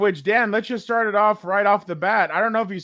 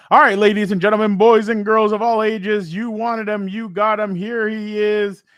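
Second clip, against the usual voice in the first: ring announcer; 3 semitones higher, 4 dB louder than usual. Pretend. Host introducing the long-awaited guest, ring-announcer style. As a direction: announce him like the star attraction.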